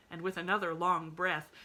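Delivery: ordinary delivery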